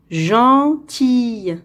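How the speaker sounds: "Gentille" slowly in two parts; slow model reading. Shown as a demonstration. Teacher reading for pupils to hear the sound, slowly. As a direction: draw out both syllables of "Gentille". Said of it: "On va lire".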